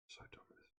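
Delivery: whispered, defeated; just breathe the words out; weary whisper. Real, not acted. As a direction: fan whispering that he is done with football. Despair at team.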